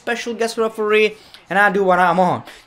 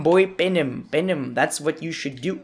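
ordinary delivery

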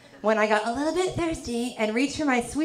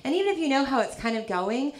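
ordinary delivery

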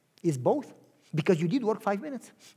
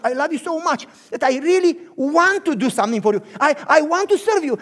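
loud, fervent pleading; loud fervent grateful plea; impassioned gratitude; 5 semitones higher, 10 dB louder than usual. Acted. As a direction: beg to serve out of love. Grateful servant insists.

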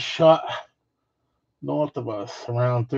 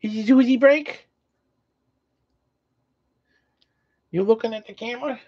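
high cooing pet voice; 10 semitones higher than usual. Real, not acted. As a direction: talk sweetly up high. Baby talk to pet.

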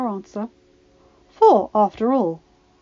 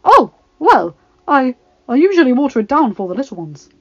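flustered; 3 semitones higher, 10 dB louder than usual. Acted. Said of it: "The woman blanched".

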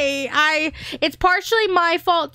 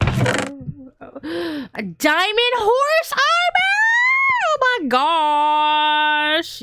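ecstatic find; 3 semitones higher, much slower than usual. Real, not acted. Finding diamond horse armor, a slow joyful shriek. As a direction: squeal it out like treasure day.

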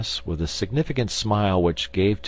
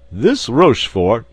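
loud, raised indignant cry; 4 semitones higher, 7 dB louder than usual. A narrator's reading. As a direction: exclaim it hotly. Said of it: "cried Porthos".

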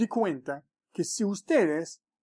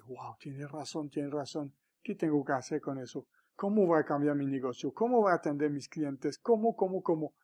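1 hushed stunned musing; 4 semitones lower, 6 dB quieter than usual. Retold or imitated. Listener's stunned inner thoughts.

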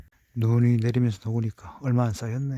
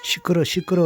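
loud hush; yelling; 6 semitones higher, 4 dB louder than usual. Real, not acted. Yelling shut up. (시끄럽다고 크게)

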